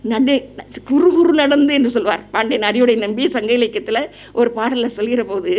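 ordinary delivery

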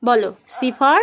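put-on bright, loud sing-song quizzing of a toddler; loud child-directed teaching. Mother quizzing her toddler on letters, in a loud sing-song. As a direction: coax the little one through letters and numbers, loud and eager.

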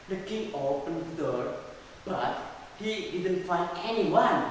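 ordinary delivery, nothing marked

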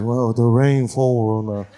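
slow put-on Chinese accent; Olivia Chow. Politician explaining her rain tax, mock Chinese accent. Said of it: "She's like".

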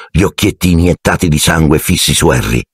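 usual speaking voice

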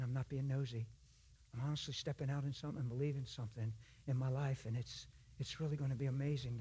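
soft, low, earnest appeal; vulnerable, sincere. Shown as a demonstration. Believer explaining himself to an overheard stranger, softly vulnerable. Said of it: "you're being really vulnerable and expressive".

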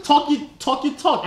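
usual speaking voice

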